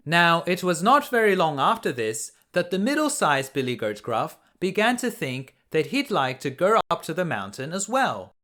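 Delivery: ordinary delivery, nothing marked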